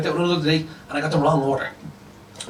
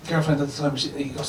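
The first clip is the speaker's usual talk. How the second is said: usual speaking voice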